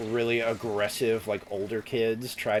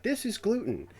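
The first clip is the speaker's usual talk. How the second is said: the expert; 7 semitones higher than usual. Retold or imitated. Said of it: "and he's like".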